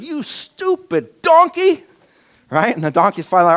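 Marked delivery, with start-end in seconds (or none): 0.0-1.8 high, slow hammed-up yell at the donkey; mock furious yell. Preacher mimics Balaam cursing his donkey, hammed-up high yell. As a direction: bellow it like a man losing his temper. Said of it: "starts beating his".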